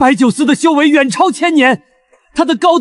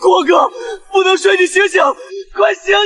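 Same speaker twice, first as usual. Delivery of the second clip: crying while calling him awake; tearful pleading. Acted. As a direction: sob and shout to wake him. Begging him to wake up.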